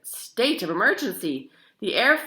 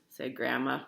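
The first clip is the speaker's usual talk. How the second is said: ordinary delivery, nothing marked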